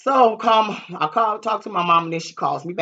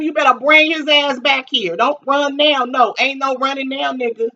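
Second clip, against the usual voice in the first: threatening; 8 semitones higher, 6 dB louder than usual. Retold or imitated. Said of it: "tell him".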